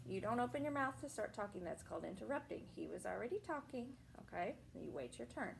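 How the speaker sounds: soft teacherly reprimand; gentle scolding. Real, not acted. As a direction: hush the interrupter and set the rule. Teacher scolds interrupting child.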